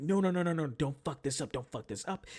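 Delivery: high, loud panicked burst, then easing; retold freak-out. Typist as someone else starts typing, panicked. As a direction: plead in a panic not to be interrupted. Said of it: "I'm freaking out".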